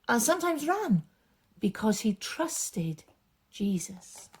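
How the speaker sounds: lively, expressive storytelling voice; story narration for children. A narrator's reading. Storyteller reading a Bible story aloud to children, animated. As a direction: paint the story big for the kids.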